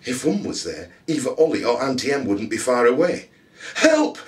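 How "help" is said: high, loud cry for help; girl yelling help. A narrator's reading. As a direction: shout it out, desperate. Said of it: "she yelled".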